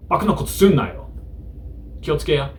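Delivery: wag a finger at the listener; playful Kansai tough-guy warning; mock Kansai scolding.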